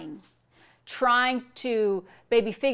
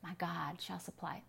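very soft, low private recital; hushed so as not to wake a spouse; murmured to oneself; 3 semitones lower, 16 dB quieter than usual. Shown as a demonstration. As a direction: say it under your breath, as if in bed. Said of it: "saying that scripture over and over to myself".

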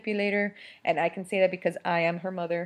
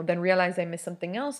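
ordinary talk

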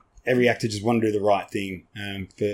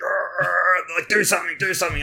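mock annoyed grunts; 7 semitones higher than usual. Annoyed boys behind him.

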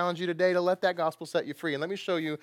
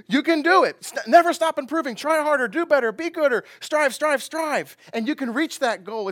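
much higher, louder, laughing rapid-fire slogans; mock cheerleader chanting; mocking hype; 11 semitones higher, 9 dB louder than usual. Preacher mocking man's way with loud laughing hype.

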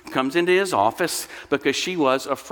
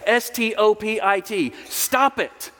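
higher-pitched emphatic order; spelled-out command; 5 semitones higher than usual. Acted. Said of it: "he yelled at her".

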